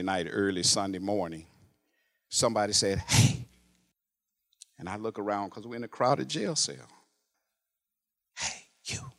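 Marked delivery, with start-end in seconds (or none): 3.0-3.5 whisper. Retold or imitated. Stranger calls softly in jail. 8.4-9.1 hushed unvoiced hiss of a call; whispered call. Inmate whispers to the preacher in a crowded cell at night. Stranger calls softly at night.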